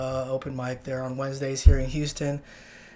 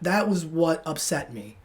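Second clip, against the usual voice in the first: usual speaking voice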